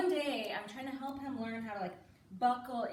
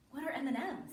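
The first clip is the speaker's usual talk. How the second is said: put-on small, puzzled child's question; innocent little girl; 3 semitones higher than usual. Daughter asking mother about candy, innocently puzzled.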